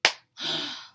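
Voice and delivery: sharp loud unvoiced gasp. Teacher gasping as the last button goes. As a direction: gasp in mock shock.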